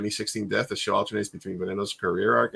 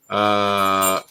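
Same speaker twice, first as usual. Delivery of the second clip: usual speaking voice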